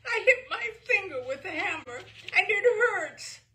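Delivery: high, loud crying, slowing at the end; tearful crying. Acted. Boy telling operator about hammer, crying. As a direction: wail out the hurt.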